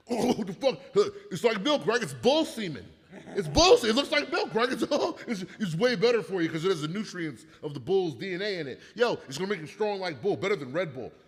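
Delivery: loud, eager salesman pushing milk that isn't milk; hyped-up pitch. Pretend. A friend hamming up an excited pitch of fake milk. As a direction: sell it like a grinning huckster, laughing through it. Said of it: "and you're like".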